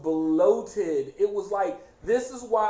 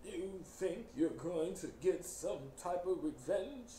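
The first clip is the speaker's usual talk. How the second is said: softer, labored villain voice; Mister Price; 3 semitones lower, 8 dB quieter than usual. Retold or imitated. Villain confronting Elliot's friend, labored voice. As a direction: struggle through it as if mid-stroke. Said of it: "he sounds like he's constantly having a stroke".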